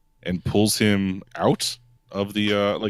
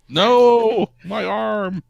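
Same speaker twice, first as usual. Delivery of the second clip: high-pitched hammed-up wail; drawn-out cry of agony; pained screaming; 14 semitones higher, 6 dB louder, much slower than usual. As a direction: scream as the injured student being dragged away. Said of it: "The screaming gets louder after that".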